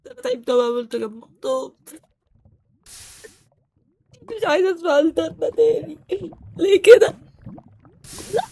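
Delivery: high whiny fake sobbing; hammed-up tearful complaint; mock crying. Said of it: "بطل عياط".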